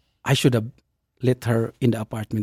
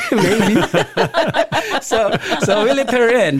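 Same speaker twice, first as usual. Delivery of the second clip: higher, laughing through the words; 10 semitones higher than usual. Real, not acted. Admitting to listeners they let her in, laughing.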